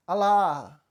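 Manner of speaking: a half-hearted first cry of "Alá!"; timid shout of Alá. Retold or imitated. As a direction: call out Alá without much force. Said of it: "aí ele gritou".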